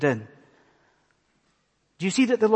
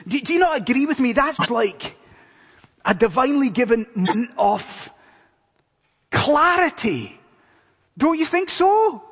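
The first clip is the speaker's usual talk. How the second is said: rising excited appeal; 8 semitones higher, 4 dB louder than usual. Real, not acted. Preacher pressing the congregation.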